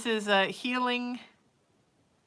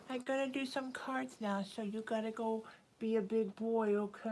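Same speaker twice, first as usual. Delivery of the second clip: pet talk; 3 semitones higher, 5 dB quieter than usual. Real, not acted. Telling her cat Sir Snuggles to go be a big boy, soft cooing pet voice. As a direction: coo at the cat gently.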